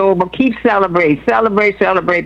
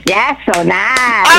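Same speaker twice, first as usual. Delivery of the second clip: attempting the slogan; 4 semitones higher, much slower than usual. Shown as a demonstration. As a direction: drag out so nice like the jingle. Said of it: "you know I can't say that too good".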